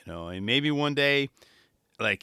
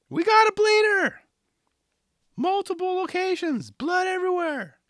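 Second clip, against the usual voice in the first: much higher put-on paramedic voice; mock medic; 4 dB louder than usual. Imagined medic calling out the crash, high hammed-up voice. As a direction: announce the injury like a hammed-up medic.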